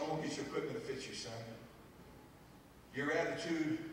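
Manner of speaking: gentle coach. Retold or imitated. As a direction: speak softly to the boy, kindly. Coach praising boy gently.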